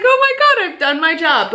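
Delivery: very high-pitched mock squeal of relief; retold elation. Shop owner reliving praise from her old school, elated. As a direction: relive the thrill, pitch leaping up.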